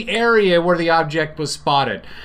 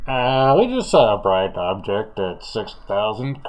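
low radio-call voice of a pilot; airline pilot; 6 semitones lower than usual. Acted. Pilot radios the tower about an object, low pilot voice. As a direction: play a pilot reporting in, pitched down. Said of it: "as the China Airlines zero zero six crew".

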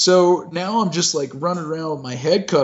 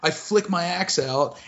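ordinary delivery

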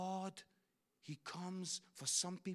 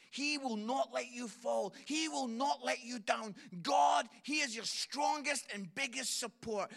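louder and higher, rising to a shout; preaching with force; 5 semitones higher, 6 dB louder than usual. Real, not acted. Preacher assuring the room God holds them, building shout. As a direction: proclaim it with full conviction.

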